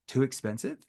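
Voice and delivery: soft, rising puzzled question; confused echo. Shown as a demonstration. Salesman echoing the price objection in a soft, confused tone. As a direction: repeat it back, baffled. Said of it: "in a confused tone".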